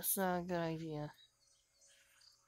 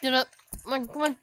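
urgent; 6 semitones higher, 10 dB louder, much faster than usual. Real, not acted. Urging character to get up.

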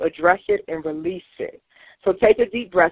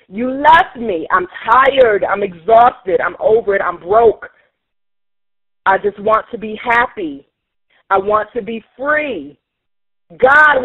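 loud, pained outcry; venting pain story; 3 semitones higher, 4 dB louder than usual. Acted. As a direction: let the hurt pour out aloud. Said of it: "I'm gonna express the pain".

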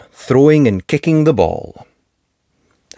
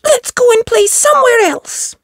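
put-on high squeaky child voice; little rabbit girl. Rebecca suggesting to Peppa they play elsewhere, squeaky. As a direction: chirp it like a small rabbit.

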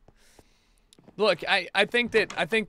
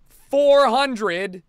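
"four hundred" nearly shouted, louder and higher; louder emphatic repeat; 9 semitones higher, 12 dB louder than usual. Real, not acted. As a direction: hammer "four hundred" home.

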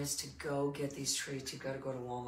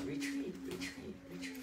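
mock alarm; 13 semitones higher than usual.

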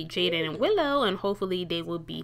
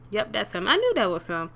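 ordinary talk

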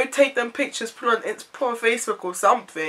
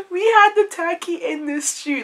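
laughing; 10 semitones higher, 6 dB louder than usual. Real, not acted. Laughing disbelief.

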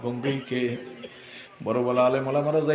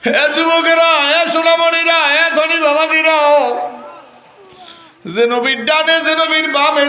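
melodic waz preaching; 13 semitones higher, 8 dB louder than usual. Acted. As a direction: preach in a sustained singing cry, stretching the phrase endings. (সুর করে উচ্চস্বরে ওয়াজ)

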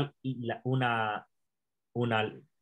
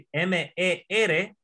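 M E R spelled out slowly; letter by letter, slowly; slow spelling; 4 semitones higher, much slower than usual. Shown as a demonstration. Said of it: "y luego mer". (deletreando M E R despacio)

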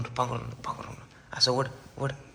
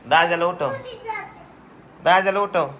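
eager urging; 8 semitones higher than usual. Real, not acted. Urging child to wake brother.

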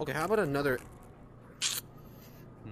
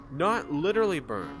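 literally stressed, falling, louder; 3 semitones higher, 4 dB louder than usual. Real, not acted. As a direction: hit the word literally hard. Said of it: "That is not how it works".

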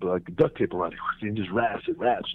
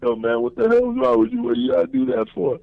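much higher, put-on whine; mock whiny protest; 13 semitones higher, 6 dB louder than usual. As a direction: complain like the victim. I'm laughing because I'm like.

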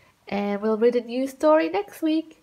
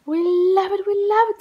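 put-on higher, eager children's cheer; excited kids; 6 semitones higher, much faster than usual. Children begging the babysitter, eager. Kids beg for more rhymes.

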